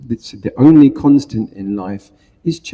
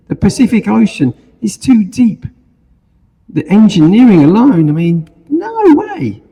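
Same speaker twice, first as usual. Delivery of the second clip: genie voice; 10 semitones higher than usual. Acted. Genie refuses the bridge.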